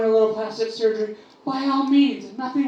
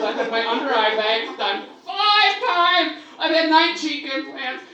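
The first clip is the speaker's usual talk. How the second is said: ordinary talk, nothing marked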